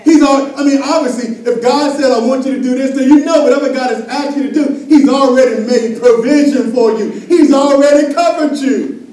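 loud, high preaching push; full-force preaching. Acted. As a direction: drive it home to the church.

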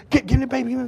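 high, loud imitation of an anxious mother; urgent wife. Imitating his wife rushing to the crying baby, urgent. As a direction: mimic a mom demanding her child. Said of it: "my wife was quick".

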